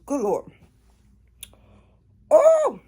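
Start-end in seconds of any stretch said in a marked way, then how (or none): 2.3-2.8 sudden high yelp of pain. Real, not acted. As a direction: yelp from the burn. Mouth burning from spice.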